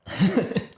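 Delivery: small real chuckle after a joke; short laugh. Real, not acted. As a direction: let out a quick amused laugh. Amused at the haircut joke.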